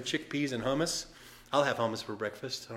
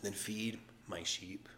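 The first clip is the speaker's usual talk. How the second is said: slow, soft whisper; 5 semitones lower, 12 dB quieter, slower than usual. Retold or imitated. Jesus gently charging Peter, slow soft whisper. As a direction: breathe it gently like a secret charge. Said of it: "Jesus is saying".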